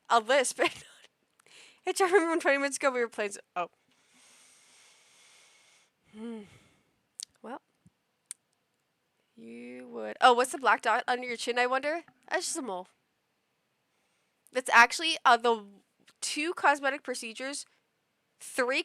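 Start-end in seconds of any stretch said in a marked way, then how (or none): none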